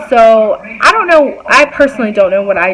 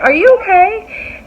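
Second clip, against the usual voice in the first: high, slow, careful question; careful concern; 9 semitones higher, slower than usual. Retold or imitated. Asking a coworker after noticing her attitude, careful. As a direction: draw it out gingerly, pitched up.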